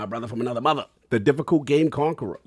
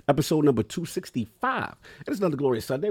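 ordinary talk

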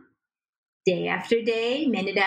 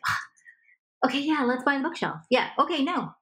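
higher, weary giving in, swatting a mosquito; giving in to make it stop; 6 semitones higher than usual. Retold or imitated. Partner worn down by repeated asking, caving with a higher, weary okay. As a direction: sigh and give in just to end the asking. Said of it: "a little mosquito is buzzing".

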